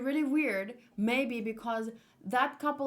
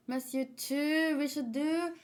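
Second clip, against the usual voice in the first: normal talking voice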